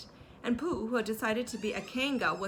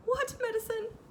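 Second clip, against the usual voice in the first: much higher worried squeak; alarmed Piglet. A narrator's reading. Piglet alarmed by the medicine, much higher.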